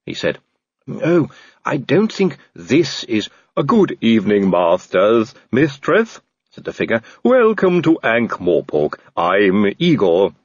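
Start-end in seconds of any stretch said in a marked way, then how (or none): 0.9-6.2 slow, polite servant voice with a different timbre; a courteous, slightly sinister butler; Igor the servant. A narrator's reading. 7.2-10.3 slow, polite servant voice with a different timbre; a courteous, slightly sinister butler; Igor the servant. A narrator's reading.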